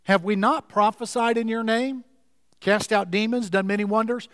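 ordinary delivery

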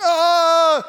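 hammed-up yelp of misery; loud pained cry. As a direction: cry out as the scorching sun hits. Jonah hit by the sun.

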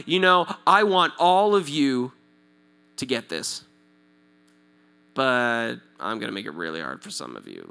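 a low mock voice for a grudging God; mock sarcasm. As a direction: mutter the unfair promise with irony.

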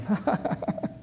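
soft, high chuckling laugh; imitated laughing. Mentor laughing at the guy's confession, imitated laugh. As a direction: laugh lightly at someone's confession. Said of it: "the other guy starts laughing at him".

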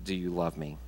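soft, low, tender question; very gentle. Retold or imitated. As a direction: ask it softly and gently, as if restoring a friend. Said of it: "he restores him very gently, saying".

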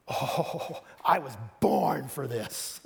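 slow, lofty, savouring it; slow self-satisfied boast; grand and self-pleased. Shown as a demonstration. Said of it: "one way of saying that".

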